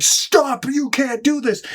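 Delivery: bursting in, crying out to stop him; mock shout. Hero breaking through the door to stop the gunman, mock shout. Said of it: "and he's like".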